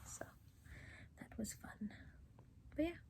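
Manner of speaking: soft whisper. Real, not acted. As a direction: barely breathe the words. Late-night quiet end of vlog.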